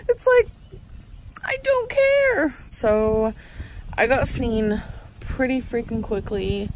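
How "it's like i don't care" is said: very high giggly voice; giddy laughing. Real, not acted. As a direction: laugh then drawl slowly up high.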